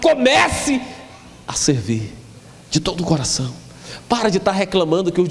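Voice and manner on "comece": fervent preacher's cry; preaching shout. Real, not acted. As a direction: build the exhortation to a shout. Preacher exhorting the congregation.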